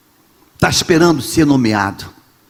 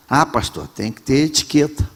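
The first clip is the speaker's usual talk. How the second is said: softer, prissy mock of a refined member; prim churchgoer; 4 semitones lower, 6 dB quieter than usual. Pastor mocking a member who wants etiquette, softer. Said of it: "Ela falou o quê?".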